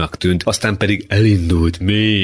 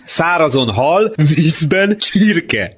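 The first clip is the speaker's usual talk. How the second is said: slow, high mocking chant; shouting; 10 semitones higher, much slower than usual. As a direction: proclaim the taunt high and drawn out. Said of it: "kiáltotta Ábel". (csúfolódó, elnyújtott kiáltás)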